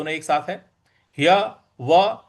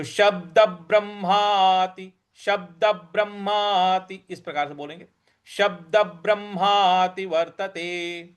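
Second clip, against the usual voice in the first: slow, careful शब्द ब्रह्माति वर्तते; teacher repeating the line; slow model recitation. Shown as a demonstration. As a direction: recite word by word. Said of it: "इस प्रकार से इसका उच्चारण करना है".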